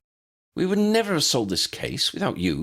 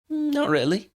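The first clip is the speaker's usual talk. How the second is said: put-on young girl. Lydia child voice.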